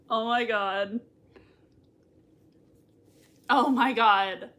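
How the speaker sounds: usual speaking voice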